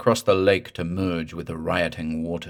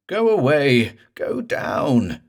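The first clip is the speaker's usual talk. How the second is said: higher, louder begging; frightened plea; 7 semitones higher, 5 dB louder, slower than usual. Acted. Morse orders the ghost down, scared and loud. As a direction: push it away in fear.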